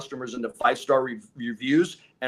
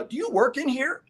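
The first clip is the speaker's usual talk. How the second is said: asks much higher, teasing the customer; a playful higher-pitched question; mock high questioning; 11 semitones higher than usual. Salesman mimicking his own puzzled question.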